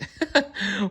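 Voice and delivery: a high, short amused laugh; elephant laughing. A narrator's reading. Elephant laughing at the bird's silly request. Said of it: "The elephant laughed".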